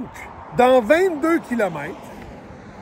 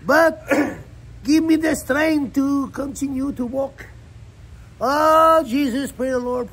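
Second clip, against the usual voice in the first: mock preacher; 5 semitones higher than usual. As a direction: preach it like a revival pastor. Mock prayer continues.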